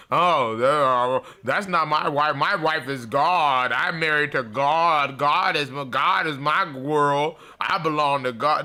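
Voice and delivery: drawn-out, hammed-up declarations; mock devout man, stretching the words; delusional man. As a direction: slowly proclaim it as a deluded husband. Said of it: "we did have some delusional man".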